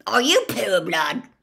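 spring it on him hard; sudden aggression. Acted. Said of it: "suddenly aggressive".

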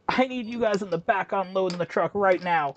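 put-on urgent order, mildly mocking; mock bossy manager. Mimics manager ordering staff around.

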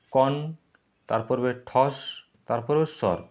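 কন, ঠস, স্বর each said apart; syllable by syllable. Shown as a demonstration. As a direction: break কণ্ঠস্বর into কন, ঠস, স্বর. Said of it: "কণ্ঠস্বরকে তুমি যদি ভাঙো". (কণ্ঠস্বর শব্দটি দলে ভেঙে আলাদা করে বলা)

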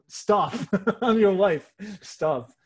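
a wife's mock telling-off, broken by a laugh; protesting Juno. Juno scolding Zeus for chasing human girls, mock protest. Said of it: "Juno is always like".

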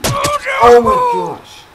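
high, drawn-out exasperated cry; exasperated gasp. Real, not acted. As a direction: wail it slowly and very high. Game failure.

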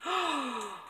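short high gasp of excitement; excited gasp. Real, not acted. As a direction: gasp at something long searched for. Gasp at red truck ornaments.

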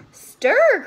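high, loud call; monster mother's cry. A narrator's reading. Mother monster to her wee monsters, crying out. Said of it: "cried the ma".

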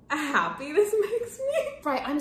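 high, stretched-out happy squeal; overjoyed. Real, not acted. Fan telling how happy the gift makes them, overjoyed. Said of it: "I don't know how to explain how happy".

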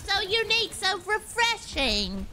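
high, theatrical British gushing; exaggerated British accent; mock British. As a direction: lay on sarcastic praise in British. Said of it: "What kind of British are you?".

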